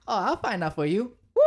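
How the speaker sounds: volunteer gleefully, then whoop; playful eagerness. Acted. Aang diving into the clouds, voiced with playful eagerness.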